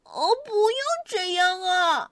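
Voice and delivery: high, distressed rabbit; upset rabbit crying out; dismayed cry. A narrator's reading. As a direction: wail over losing the bear. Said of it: "兔子喊起来".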